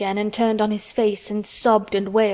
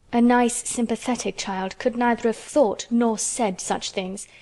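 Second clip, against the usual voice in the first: plain reading voice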